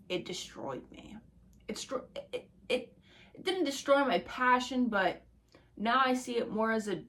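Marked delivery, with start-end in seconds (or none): 0.1-3.4 whispered. Real, not acted. Quietly admitting defeat.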